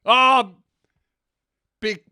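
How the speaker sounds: loud burst, then halting, slow answer; hammed-up flustered indignation; mock angry stammer. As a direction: bluster high, then grope for a reason. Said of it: "I just got angry".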